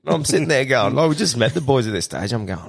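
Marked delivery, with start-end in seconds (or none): none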